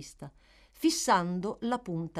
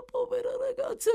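much higher, crying lament; anguished cry; 3 dB louder than usual. Acted. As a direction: let the grief break through. Dying woman grieving the girl.

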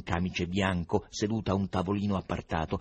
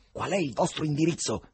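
ordinary delivery, nothing marked

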